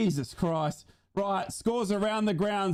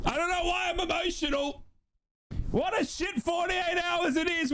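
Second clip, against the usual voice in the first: emotional shout; 9 semitones higher than usual. Real, not acted. Emotional after the win.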